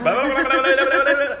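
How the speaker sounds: hammed-up tongues babble; mock tongues babble. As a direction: gabble high and loud.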